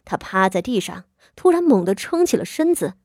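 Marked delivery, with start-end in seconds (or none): none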